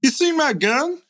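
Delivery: squeaky high guest voice; goofy high voice. Acted. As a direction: play a silly high-voiced partygoer. Voicing orgy guest losing gun.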